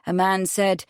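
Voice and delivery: ordinary delivery, nothing marked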